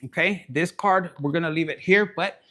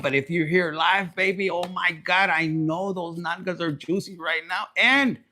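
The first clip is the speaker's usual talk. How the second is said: usual speaking voice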